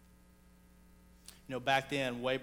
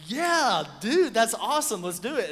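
louder and higher, thrilled; excited cheer; 8 semitones higher, 9 dB louder, slower than usual. Retold or imitated. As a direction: cheer him on. Group cheering his offer to pray.

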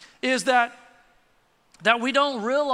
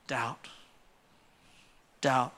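dropped low; 11 semitones lower, 8 dB quieter than usual. Real, not acted. One-word emphatic answer.

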